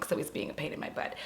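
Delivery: hushed, fast whispered aside. Real, not acted. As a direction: whisper it under your breath, quickly. Muttered aside about the dog.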